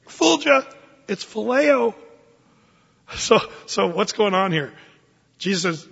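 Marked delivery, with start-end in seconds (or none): 0.1-1.9 Fulgia said high and loud as the Greek word; Greek word phileo. Shown as a demonstration. Preacher calls out the Greek word to the congregation, emphatic. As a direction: pronounce phileo as fuh-LAY-oh with a lifted pitch. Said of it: "the word lovest from Jesus is what?".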